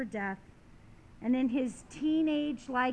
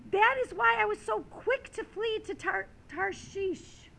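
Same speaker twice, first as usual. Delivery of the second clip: high-pitched, indignant complaint to God; acted exasperated outburst; angry prayer; 8 semitones higher, 3 dB louder than usual. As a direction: protest angrily, pitched up high. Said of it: "became angry".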